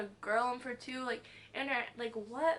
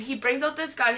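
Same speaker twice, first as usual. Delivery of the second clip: ordinary talk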